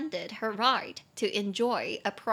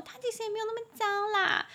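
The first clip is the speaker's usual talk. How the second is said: put-on high, quick girlfriend voice; playfully downplay it, voice raised; girlfriend's voice; 12 semitones higher, much faster than usual. Girlfriend gently defending his friend, high and quick. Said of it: "然后女朋友说".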